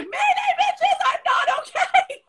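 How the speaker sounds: very high, drawn-out cry for help; over-the-top squealed SOS; mock distress call. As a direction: wail a hammed-up mayday, half laughing. Acts out her distress call.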